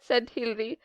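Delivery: plain reading voice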